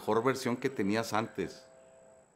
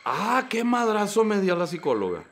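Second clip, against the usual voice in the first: ordinary delivery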